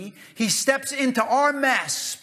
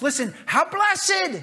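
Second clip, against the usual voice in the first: fired up; 9 semitones higher, slower than usual. Retold or imitated. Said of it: "David's getting all fired up here".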